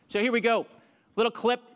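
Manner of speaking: loud, high leader calls over music; rally the crowd over the beat; pumped-up warm-up host shouting over music; calling over music. Real, not acted. Leads clapping over music.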